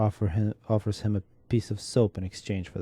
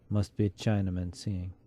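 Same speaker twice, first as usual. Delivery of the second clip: usual speaking voice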